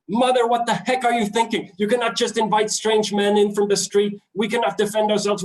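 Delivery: high, hammed-up scolding of mother; daughter shouting. Imitating the daughter scolding her mother for inviting a stranger. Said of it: "the daughter looks at her mother and shouts".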